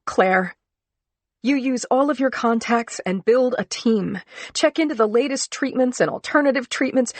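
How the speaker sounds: rally her to fight; fierce pep talk. Acted. Rallying speech to sick friend.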